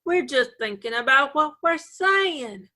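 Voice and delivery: put-on drawn-out, protesting Georgia accent; slow Georgia drawl. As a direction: take your time in a Southern drawl from Georgia. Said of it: "like we're slow".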